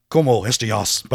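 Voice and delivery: lightly put-on Spanish accent; Puss in Boots accent. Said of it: "I sounded like fucking Puss in Boots".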